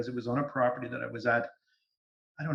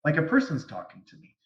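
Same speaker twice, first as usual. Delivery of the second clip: ordinary delivery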